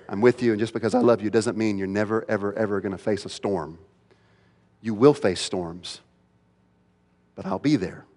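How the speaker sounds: low, hushed comforting voice; gentle reassurance. Acted. Jesus to disciples about facing storms, low gentle reassurance. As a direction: comfort them softly and steadily.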